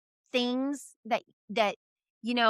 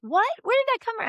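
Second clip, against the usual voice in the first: much higher, mock baffled daughter; surprised daughter; 11 semitones higher, faster than usual. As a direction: imitate her shock at the money. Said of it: "And you're like".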